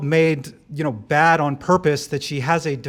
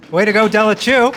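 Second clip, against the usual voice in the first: louder and higher, cheering her on; a proud public cheer for his wife; cheering shout-out; 6 semitones higher, 10 dB louder than usual. Real, not acted. Husband cheering his wife at her first political event, loud.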